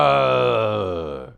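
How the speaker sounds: loud roar with an odd voice; imitating the storm's roar, loud; mock roar. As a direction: roar like thunder in the night. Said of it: "but ten times louder".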